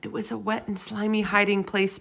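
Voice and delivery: usual speaking voice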